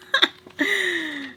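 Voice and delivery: high-pitched mock chuckle; imitated chuckle to herself. Storyteller acting out her chuckling at the suitcase dates, high imitated chuckle. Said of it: "she chuckles to herself".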